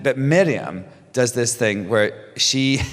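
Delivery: ordinary talk, nothing marked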